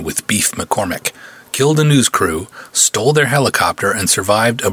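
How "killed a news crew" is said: ordinary delivery, nothing marked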